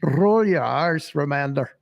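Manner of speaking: playful rolled R on "roll" and "Remander"; "roll" and "Remander" each begun with a trilled R; rolled R's. Shown as a demonstration. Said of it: "it's such fun though to roll your R's".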